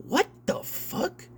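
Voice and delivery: a hushed what the fuck; stunned whisper. Real, not acted. Reading the CEO's plan, he whispers in disbelief. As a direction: breathe it out, slow and soft, in disbelief. Stunned by per-hour pricing.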